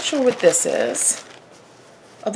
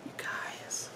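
breathless whisper, much softer; awed whisper; 16 dB quieter than usual. Real, not acted. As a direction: whisper it, too moved to speak. Overwhelmed by the gift.

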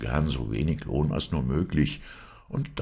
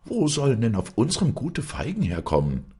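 high, indignant question; exasperated high-voiced scolding; angry wife; 9 semitones higher, 4 dB louder than usual. A narrator's reading. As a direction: demand an answer in a raised pitch. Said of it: "schrie die Frau und wurde zornig".

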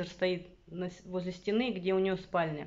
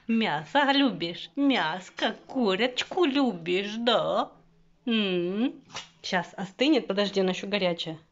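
louder sing-song voice for the dog; talking to a dog; 5 dB louder, slower than usual. Real, not acted. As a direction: coo to the dog, louder and slow.

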